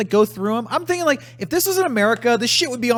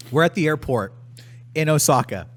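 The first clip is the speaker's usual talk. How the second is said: ordinary delivery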